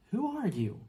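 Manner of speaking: put-on a bewildered boy blurting it out; young Harry asks the giant, awed; boy's voice.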